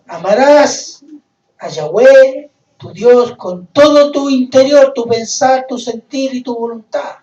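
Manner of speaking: loud scriptural command, easing at the end; forceful proclamation. Acted. Preacher expands the commandment to love God, forceful proclamation. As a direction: preach each phrase out with rising force.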